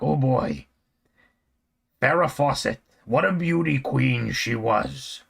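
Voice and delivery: put-on slow old-time wise guy drawl; slow mock mobster voice; old-timey mobster. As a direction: put on a mob guy admiring a pinup. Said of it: "My dad didn't talk like that".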